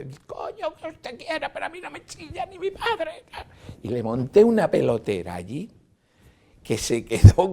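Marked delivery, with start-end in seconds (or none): 0.0-3.2 very high, strained mock shouting; re-enacted screaming retort; retold yell back. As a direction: yell back at the same pitch of fury. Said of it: "le grité al mismo nivel".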